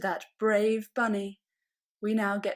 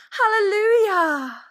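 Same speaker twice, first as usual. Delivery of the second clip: high, loud, drawn-out squeal of joy; joyful squeak; 11 semitones higher, 15 dB louder than usual. A narrator's reading. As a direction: rabbit overjoyed at her egg. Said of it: "the rabbit squeaked".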